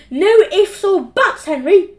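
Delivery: loud, high, slow telling-off; screeched scolding. A narrator's reading. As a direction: cut off all argument, loudly. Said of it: "screeched Mum".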